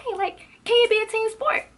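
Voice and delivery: loud, very high-pitched exasperated question; exasperated outburst. Retold or imitated. Student calls out the teacher's pet, loudly exasperated. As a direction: burst out high and loud in disbelief. Re-enacting her outburst to classmate.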